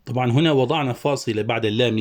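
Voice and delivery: ordinary talk, nothing marked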